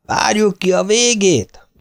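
high, slowed shout back over the noise. Retold or imitated. Teammate shouting back over the music, high and slow. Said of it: "ordította vissza".

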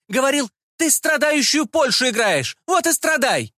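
very high-pitched, insistent complaint; aggrieved servant woman. A narrator's reading. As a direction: denounce your tormentor to the crowd, voice pitched high. Servant woman denounces tormentor to crowd.

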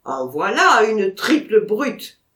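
loud, slow, indignant exclamation; exasperated outburst. Acted. Vatrin scolding the pointer around the tree, loud and slow. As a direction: hammer out the insult at the dog. Vatrin fuming at the stubborn dog.

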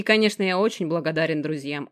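ordinary delivery

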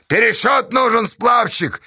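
high, insistent call; demanding shout. Acted. Bargeman demanding a recount from raftsman, shouting.

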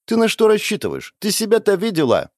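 put-on high, sneering girl's voice; a high, haughty taunt at the small girl; mocking blonde. A narrator's reading. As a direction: look down on her rival, high and scornful. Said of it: "говорила высокая фигуристая блондинка".

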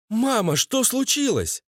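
high-pitched wife asking her mother; wife's voice. A narrator's reading. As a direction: ask high, lightly voicing a woman. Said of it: "Голос жены Валеры". (высокий голос жены)

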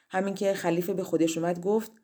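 usual speaking voice

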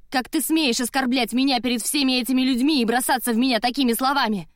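high, loud tirade; threatening, indignant outburst. A narrator's reading. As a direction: let the outrage ring out at full voice. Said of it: "Я всё сильнее повышала голос".

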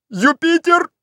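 very high call upward; urgent high holler; shouted name. A narrator's reading. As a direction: shout up to the climber, ignoring everyone else. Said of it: "закричал он".

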